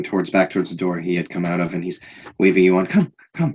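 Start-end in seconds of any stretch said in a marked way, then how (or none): 2.9-3.5 put-on a big man gushing like a child; odd overjoyed guard greeting a friend; eager childlike Anton. GM voices Anton.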